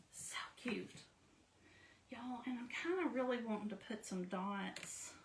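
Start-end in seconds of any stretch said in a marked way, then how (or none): 0.1-0.9 hushed adoring whisper. Real, not acted. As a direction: whisper it to the craft lovingly. Admiring her finished craft.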